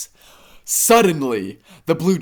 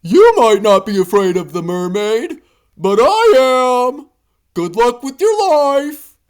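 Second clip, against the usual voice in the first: high, louder fearful warning; worried panda; 10 semitones higher, 6 dB louder than usual. A narrator's reading. Panda to hippo, high louder warning. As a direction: admit fear, then wish luck. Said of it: "the panda says".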